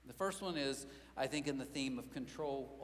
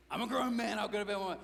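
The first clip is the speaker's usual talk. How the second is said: louder, higher mock-indignant; indignant protest; 4 semitones higher, 6 dB louder than usual. Dad protesting to his daughter, mock indignant. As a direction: huff like an offended grown-up. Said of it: "I'm like".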